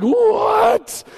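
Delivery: high, loud astonished shout; amazed. Retold or imitated. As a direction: shout in delighted disbelief. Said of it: "And you're like".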